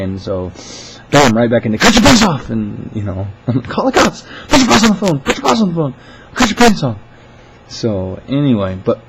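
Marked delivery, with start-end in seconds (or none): 1.8-2.5 high, loud outburst; mock yelling. Retelling an irate caller's outburst, mock yell. 3.7-7.0 high, loud repeated demands; a caller ranting at full pitch; mock yelling. Imitating a caller demanding the boss on the phone, mock yelling.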